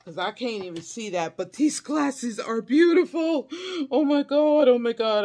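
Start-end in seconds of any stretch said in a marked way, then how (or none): 1.4-5.2 excited gushing. Real, not acted. Admiring the beautiful gifted glasses, excited gushing. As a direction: gush loudly, starting high and easing down.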